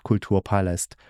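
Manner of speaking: ordinary talk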